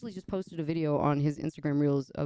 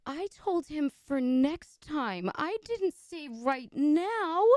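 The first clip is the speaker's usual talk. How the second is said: high, slow whiny mimic; mocking snooty voice; 12 semitones higher, slower than usual. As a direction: imitate a picky diva, high and drawn out. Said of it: "And she was like".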